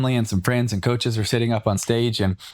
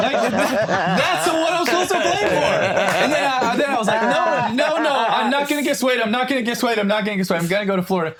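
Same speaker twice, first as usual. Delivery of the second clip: laughing while talking; 14 semitones higher than usual. Real, not acted. Retelling his decision to the hosts, laughing. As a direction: laugh your way through the lines.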